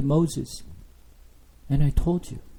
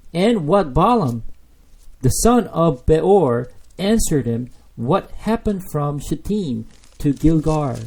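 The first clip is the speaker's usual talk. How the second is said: scripture read as God; 3 semitones higher, 4 dB louder than usual. Acted. Preacher reading God's words in Micah, raised and slow. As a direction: proclaim the verse with weight. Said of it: "the really important verse".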